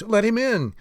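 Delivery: high, eager call; excited call. A narrator's reading. Another voice behind the door, excited call. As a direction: call it out with pitch lifted well up. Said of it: "came another".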